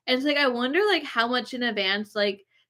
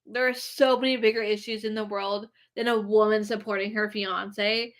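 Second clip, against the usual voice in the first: usual speaking voice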